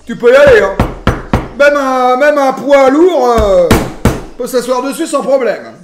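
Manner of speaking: loud, booming assurance; emphatic boast. Real, not acted. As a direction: vouch for it at full volume. Boasts how solid the counter is.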